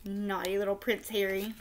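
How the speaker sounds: ordinary delivery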